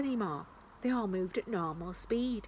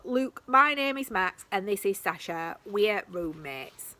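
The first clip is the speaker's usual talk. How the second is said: slow careful speech; 4 dB louder, slower than usual. Acted. Max speaking to tiny people.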